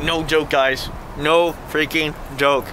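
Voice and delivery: normal talking voice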